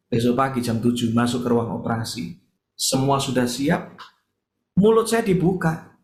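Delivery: normal talking voice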